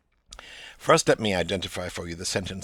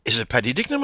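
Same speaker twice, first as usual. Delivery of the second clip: McCoy asking; 3 semitones higher, 6 dB louder, faster than usual. Acted. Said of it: "reported speech again".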